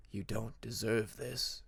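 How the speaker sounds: slow, low, soft menacing whisper. Acted. Unseen dragon whispering a threat to Peacemaker, slow and soft. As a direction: breathe it into his ear from nowhere. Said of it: "whispering softly".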